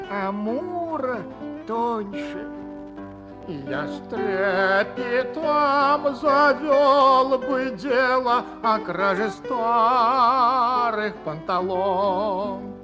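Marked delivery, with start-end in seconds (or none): 3.5-12.7 loud, slow, swooning poetic outburst; rapturous declaiming. Acted. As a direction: proclaim it like a lovesick poet.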